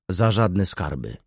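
ordinary delivery

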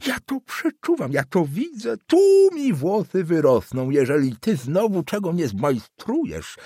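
prophesy doom, jabbing each phrase; agitated old councillor. Acted. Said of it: "wołał".

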